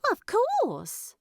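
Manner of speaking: lightly voiced Primula, puzzled and quick; Primula's voice. Primula answers matter-of-factly.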